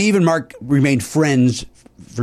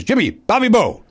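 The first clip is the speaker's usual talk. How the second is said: lightly acted, higher-pitched phone hello; mildly hyped greeting; 7 semitones higher than usual. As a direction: imitate an upbeat caller announcing himself. Retold excited phone greeting.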